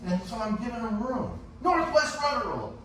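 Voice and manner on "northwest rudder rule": high-pitched mock hail; imitated call-out. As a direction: repeat the bluff as a high call. Rival sailor repeats his call.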